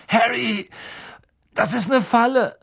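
put-on high, loud strained young voice; pained urgent warning. A narrator's reading. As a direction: warn him through the pain. Ron in pain warns Harry. (gequälte, dringende Warnung)

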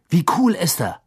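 high-pitched, gushing question; excited admiration; awed enthusiasm. Acted. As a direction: rave about him. Fred raves about Moody.

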